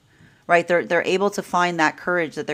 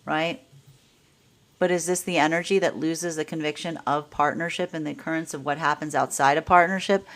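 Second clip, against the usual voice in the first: ordinary delivery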